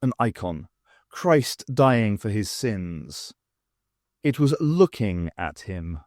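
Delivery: plain reading voice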